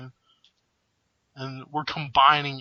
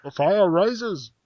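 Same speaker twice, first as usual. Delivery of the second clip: slow, higher muffled villain voice; Bane voice; 5 semitones higher, 4 dB louder, slower than usual. Retold or imitated. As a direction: hand over mouth for a masked villain. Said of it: "That was actually really good".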